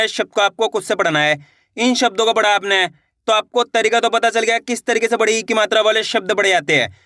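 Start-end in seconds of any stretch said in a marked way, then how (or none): none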